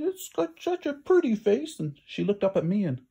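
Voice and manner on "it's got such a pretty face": high, slow, tender farm-wife voice; a motherly woman cooing over a kitten; concerned farm wife. Acted. Farmer's wife voiced in story.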